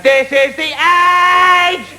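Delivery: "age" held long on a high note; drawn-out AGE. Acted. Kevin Turvey signing off until next week, "age" stretched high. Mock catchphrase sign-off, word held.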